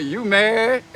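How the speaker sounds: mocking taunt. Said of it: "You see what I put?".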